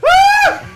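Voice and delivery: high shrieking yell of joy; excited scream. Retold or imitated. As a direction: scream like a thrilled kid. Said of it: "just freaking out".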